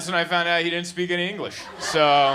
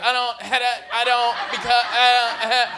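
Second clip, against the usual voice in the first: higher, hesitant mock driver stammer; flustered driver; 6 semitones higher, 5 dB louder than usual. Comic imitating the driver stuck at the fork. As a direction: fumble for words like the lost driver. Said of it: "he's like".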